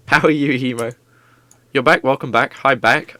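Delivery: usual speaking voice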